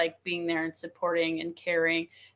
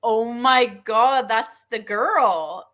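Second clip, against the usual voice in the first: stunned realization; 4 semitones higher, 6 dB louder, much slower than usual. Retold or imitated. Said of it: "And I was like".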